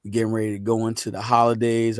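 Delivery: ordinary talk, nothing marked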